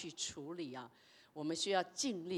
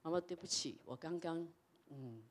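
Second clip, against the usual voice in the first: soft, lower, hesitant sorry to mom; timid apology; 4 semitones lower, 8 dB quieter than usual. Retold or imitated. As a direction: force out a quiet, sheepish apology. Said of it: "我就跟妈妈说". (轻声、低沉、犹豫地向妈妈道歉)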